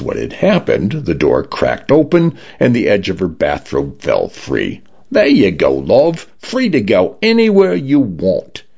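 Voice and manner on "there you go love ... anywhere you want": pitched-up, friendly Cockney home; breezy Cockney voice letting her go; lightly voiced Cockney, bright and easy; cheery Cockney condo. A narrator's reading. Cockney condo releasing door.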